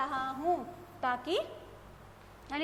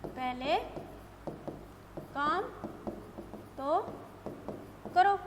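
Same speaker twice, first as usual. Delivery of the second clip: long pause after every word; slow dictation, word by word; 4 dB quieter, slower than usual. Shown as a demonstration. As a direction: dictate it slowly for the class. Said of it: "जैसे मैं लिख दूं".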